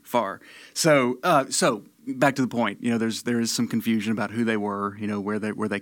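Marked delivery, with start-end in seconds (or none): 0.0-0.4 "far" for fire, Tennessee style; Southern "far", meaning fire. Shown as a demonstration. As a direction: say fire as one syllable, "far". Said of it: "pronounced fire in, in thus manner".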